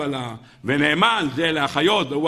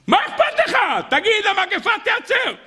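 high-pitched shouted insistence; exasperated urging. Acted. Townsfolk push the drunkard to say the words, exasperated urging. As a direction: push him impatiently, voice leaping up. Townsfolk pressing the reluctant man.

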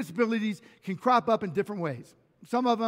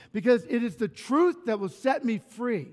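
ordinary delivery

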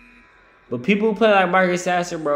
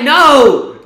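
drawn-out shouted no, high and loud; mock outraged no; 9 semitones higher, 12 dB louder than usual. Retelling a sports argument, a slow shouted no. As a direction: bellow the no at your friend. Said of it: "I'm like".

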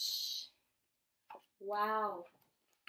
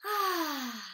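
long falling "ahh", raised and audible; relaxed sigh; 5 semitones higher, 5 dB louder than usual. Shown as a demonstration. Showing a child how to relax, big relaxed sigh. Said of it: "relax like this".

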